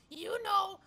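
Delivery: ordinary delivery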